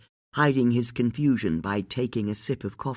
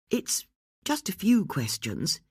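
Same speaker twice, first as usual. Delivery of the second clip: kindly; 7 semitones higher than usual. A narrator's reading. Bex calming the irritated lady of the house, kindly. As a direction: soothe her, take your time. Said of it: "as kindly as possible".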